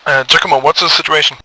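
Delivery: loud radio call-out. Acted. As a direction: call the unit over the net. Leader on radio.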